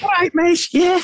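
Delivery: very high-pitched Scouse accent; squeaky, put-on Scouse. Shown as a demonstration. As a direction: do an exaggerated Scouse greeting. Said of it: "I would do my Scouse accent".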